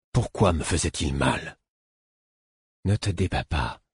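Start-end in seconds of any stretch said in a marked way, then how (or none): 2.8-3.8 understated imploring request. A narrator's reading. Fool asking his struggling patient to hold still, mildly imploring. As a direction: plead gently, without force. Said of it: "me demanda-t-il d'un ton implorant".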